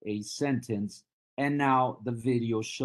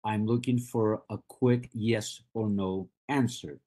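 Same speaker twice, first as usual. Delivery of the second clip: ordinary talk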